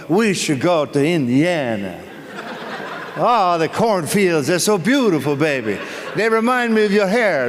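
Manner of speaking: hammed-up Italian lover wooing his baby; Italian husband. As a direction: play a smitten Italian guy selling his dream. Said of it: "a guy from Italy".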